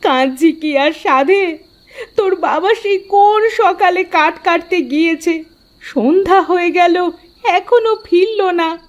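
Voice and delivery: high, crying complaint; high teary lament; tearful mother. Acted. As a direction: sob out the worry to your child. Mother weeping, husband not home.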